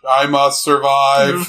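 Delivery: slow, loud robotic monotone; Soundwave. Acted. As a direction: imitate a robot spacing out each word. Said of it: "talks like this".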